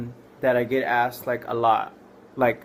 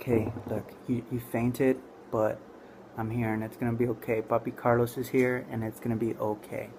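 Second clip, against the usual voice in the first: soothing reassurance; 2 semitones lower, 8 dB quieter than usual. Retold or imitated. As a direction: talk someone gently back from a faint. Comforting the girl who fainted.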